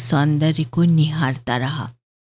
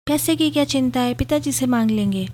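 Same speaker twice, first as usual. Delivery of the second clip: higher, fast, blurted out; quick retort; 7 semitones higher, faster than usual. A narrator's reading. Bride retorts quickly to husband, higher and fast. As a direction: snap back without a thought. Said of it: "Surabhi तपाक से बोली".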